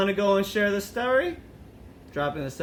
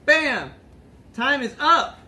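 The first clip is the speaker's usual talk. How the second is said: higher, louder punchy "bam! Time is up!"; announcer call; 6 semitones higher, 5 dB louder, slower than usual. Acted. To the audience closing the episode, announcer call. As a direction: call it out like a show host.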